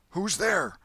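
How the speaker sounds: sharp high call through the window. A narrator's reading. Man at the window calling out to a knock, loud. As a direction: call out, alarmed, into the night. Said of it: "A call came from a window".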